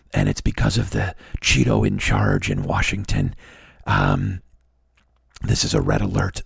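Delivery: low, fake-somber activist bulletin; Michael Moore. Mocking a filmmaker's red alert to the nation, fake somber. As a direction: sink into a weary, self-important low drone. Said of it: "fake, somber nonsense".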